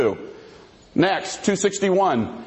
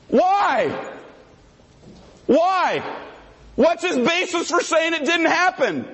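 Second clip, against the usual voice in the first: indignant demand; 10 semitones higher, slower than usual. Real, not acted. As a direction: fire the why at your opponent. Demanding opponent's basis.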